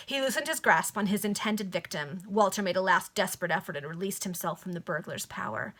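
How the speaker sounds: usual speaking voice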